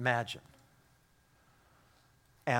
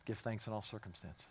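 much softer, falling answer to each trouble; softer reminder; 3 semitones lower, 12 dB quieter than usual. Acted. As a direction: say it gently, almost under the breath.

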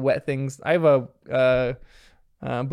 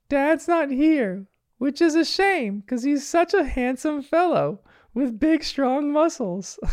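slow, very high falsetto; dad badly posing as mom while praising himself; Chili, awful mimicry; 10 semitones higher, slower than usual. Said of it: "He keeps on going".

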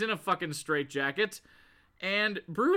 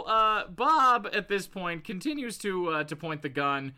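ordinary talk, nothing marked

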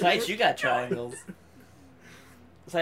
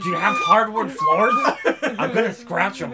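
loud, goofy spaceman character; silly put-on voice; 4 dB louder than usual. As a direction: play the spaceman through laughter. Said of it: "I'm just imagining that character walking around".

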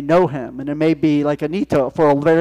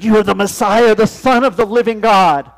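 loud, higher proclaiming voice; preaching scripture; 5 semitones higher, 7 dB louder than usual. Acted. Preacher reading Peter's confession, proclaiming loudly. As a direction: proclaim it to the congregation.

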